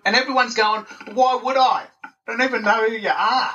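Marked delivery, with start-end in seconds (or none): 1.1-3.5 high, put-out retort; mock annoyed follower. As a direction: sound fed up with the spam. Followers rejecting the pushy sell.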